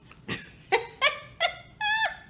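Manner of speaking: high, squealing burst of laughter. Real, not acted. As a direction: let the laugh break high. Laughing at her own story.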